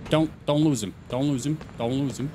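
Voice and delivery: low mutter fading to very soft; muttered self-coaching. Real, not acted. Player talking to himself while tailing a car, fading mutter.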